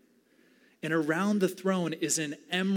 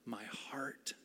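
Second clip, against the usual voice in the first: whisper; 3 semitones lower, 12 dB quieter than usual. Pretend.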